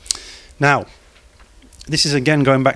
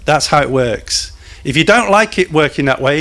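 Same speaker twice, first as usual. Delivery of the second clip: normal talking voice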